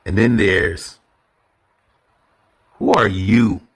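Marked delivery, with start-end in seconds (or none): 2.8-3.6 louder sarcastic who are you. Shown as a demonstration. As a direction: throw it out with sarcasm. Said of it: "now it's the sarcasm".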